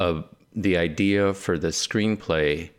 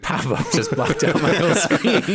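laughing through the words, high; amused, words dissolving into laughter; 10 semitones higher, 4 dB louder than usual. Real, not acted. Laughing at pop-up blocking screen.

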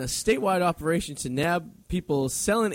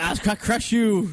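tough guy; 5 semitones higher, slower than usual. Retold or imitated. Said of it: "He's like".

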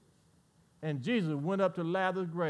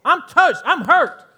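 louder and higher, with laughter breaking in; full-force preaching; 5 semitones higher, 10 dB louder than usual. Acted. Preacher voices Jesus grieving before the grave, loud preaching. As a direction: cry out the hurt and the call.

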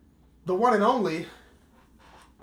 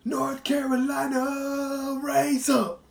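hype shout-out; 10 semitones higher, 4 dB louder, much slower than usual. Acted. Hype shout-out of home state.

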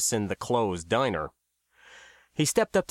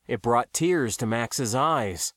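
usual speaking voice

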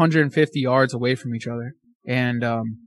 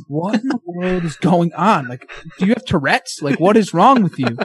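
higher-pitched, mock-incredulous questioning; exasperated bafflement; 3 semitones higher than usual. Hunter asking a twitchy deer what's wrong, exasperated. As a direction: replay being baffled, pitch up.

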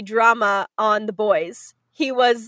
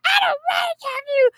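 very high, hammed-up fit; mock tantrum; faster than usual. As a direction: squeal it out like a sulking man. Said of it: "throws a fit".

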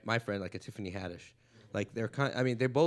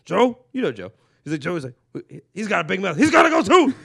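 building to a loud, high bellow; comic building to a yell as Diaz; mock shout building; 6 semitones higher, 12 dB louder than usual. Acted Diaz riff.

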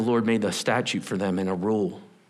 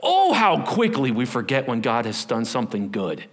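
ordinary delivery

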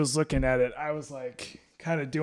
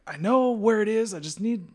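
higher, defensive insistence; husband brushing off wife, defensive; stubborn insistence; 7 semitones higher than usual. Retold or imitated. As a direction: insist you've got it under control. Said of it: "I'm like".

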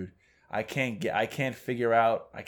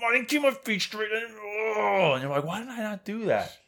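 high, whiny self-scolding; mock golfer berating himself, pitched up; 10 semitones higher than usual. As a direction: squeak at your own mistake.

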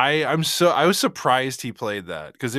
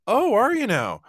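high-pitched, louder acted reply; feigned casual interest; 11 semitones higher, 5 dB louder than usual. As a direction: act politely surprised, pitched way up. Acts out own wary reply.

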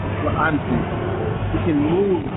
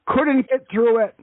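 put-on higher-pitched elderly grandpa voice; old man voice; 5 semitones higher than usual. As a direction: play a proud old war veteran. Prank caller plays old veteran.

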